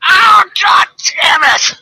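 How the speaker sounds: loud high cry of pain, drawn out; howling in sudden pain; pained yell. Retold or imitated. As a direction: yell it like your eyebrow just cramped. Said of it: "And I remember just going".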